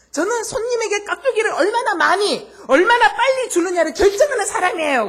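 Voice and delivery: high, very loud triumphant shout; a part-timer shouting his winning comeback at the customer; triumphant punchline. Retold or imitated. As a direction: deliver the comeback like a victory.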